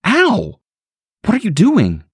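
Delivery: high, pained yelp then a shocked question; startled cry of pain. Acted. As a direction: cry out as if bitten. Stacy bitten by her baby.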